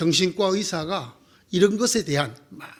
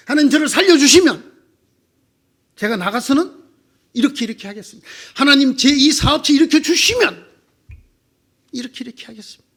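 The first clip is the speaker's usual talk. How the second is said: louder pleading prayer, offering deals; bargaining prayer; 4 semitones higher, 7 dB louder, faster than usual. Retold or imitated. Believer begging God to save his business, loud pleading. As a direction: plead loudly and strike a deal. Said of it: "하나님과 협상을 하는 거예요".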